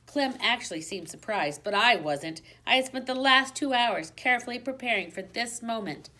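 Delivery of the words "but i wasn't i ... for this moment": ordinary delivery, nothing marked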